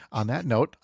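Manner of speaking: ordinary talk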